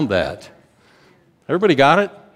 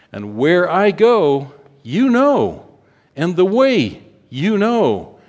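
higher, louder, slow proclamation; fervent preaching; 5 semitones higher, 4 dB louder than usual. Acted. Preacher proclaiming Christ's return to the congregation, fervent. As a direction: build to a ringing cry.

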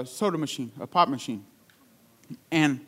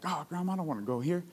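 softly imitated childish pout; mock reluctant grumble, kept soft; retold pout; 8 dB quieter than usual. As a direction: pout quietly to grandma, lightly acted. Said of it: "I would kinda pout".

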